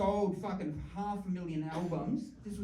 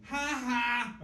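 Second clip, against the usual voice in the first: flat mocking ha ha; a dry, sarcastic two-beat laugh; 5 semitones higher, 5 dB louder than usual. Son mocking his dad's joke with a sarcastic laugh. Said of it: "sarcastic laugh".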